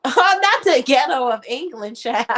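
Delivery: loud, laughing exclamation. Real, not acted. Reacting to a viewer comment, loud laughing disbelief. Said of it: "laughing at the remark".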